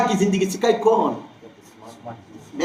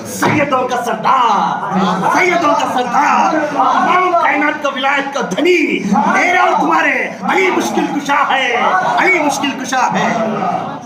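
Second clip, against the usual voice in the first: impassioned preaching; 7 semitones higher, 3 dB louder than usual. Real, not acted. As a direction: whip the congregation up toward a chant. Preaches at full force.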